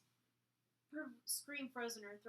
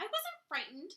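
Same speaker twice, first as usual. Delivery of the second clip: snapped, higher and louder; 10 semitones higher, 10 dB louder than usual. A narrator's reading. Elena snapping at the stranger that she wasn't afraid. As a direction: snap back defensively. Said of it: "she snapped".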